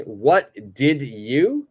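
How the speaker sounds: slow, separate "What did you"; slow full form. Shown as a demonstration. Teacher showing the unjoined words slowly. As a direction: spell out each word slowly. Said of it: "join it together".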